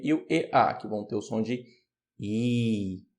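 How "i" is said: "I" said as a long, held "ee"; long I sound. Shown as a demonstration. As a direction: hold "I" as one steady "ee" vowel. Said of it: "aquele I longo". ("I" longo, como um "i" sustentado)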